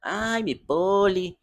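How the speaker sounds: slow, drawn-out character whine; lightly voiced Chimoltrúfia, stretched out; Chimoltrúfia impression. Fan showing how Chimoltrúfia talked, slow and drawn out. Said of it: "Mas ela falava desse jeito".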